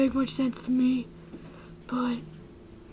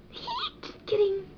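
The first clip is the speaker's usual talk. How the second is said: ordinary talk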